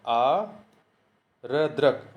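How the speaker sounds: आ-र-द्रक broken into slow syllables; spelled out slowly. Shown as a demonstration. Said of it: "आद्रक होता है". (आ-र-द्रक धीरे धीरे अक्षर तोड़कर)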